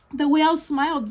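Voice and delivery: ordinary delivery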